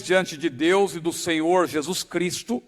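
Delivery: usual speaking voice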